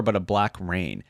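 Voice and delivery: ordinary talk, nothing marked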